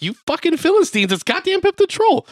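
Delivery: mock outraged fan. Fan scolding the theater crowd, high mock-outraged yell. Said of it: "I'm like".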